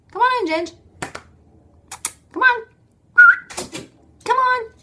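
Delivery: high-pitched coaxing call; real sing-out to a pet; calling a dog. Real, not acted. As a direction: coax the dog in with short, high calls.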